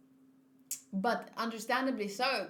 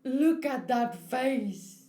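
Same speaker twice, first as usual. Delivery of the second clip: amazed; 6 dB louder, much slower than usual. Real, not acted. Reacting to a shocked face.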